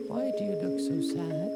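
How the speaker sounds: ordinary talk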